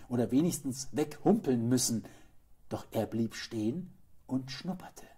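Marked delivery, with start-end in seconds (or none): none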